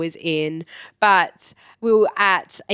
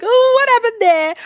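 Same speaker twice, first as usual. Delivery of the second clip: shocked reaction; 7 dB louder than usual. Retold or imitated. Tour leader spots the hole in the bamboo veranda, shocked. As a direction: exclaim it high and loud in mock horror.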